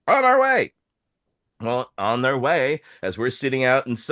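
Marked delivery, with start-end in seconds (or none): 0.1-0.7 high, chirpy, a little louder; eager reply. Retold or imitated. Friends in Bellingham agreeing to pick them up, high chirpy. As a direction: sing it out like a friend ready to rescue. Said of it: "And they're like".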